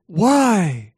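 loud, high-pitched challenge; exasperated teacher. Retold or imitated. Teacher questioning Sue's refusal, exasperated.

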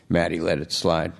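plain reading voice

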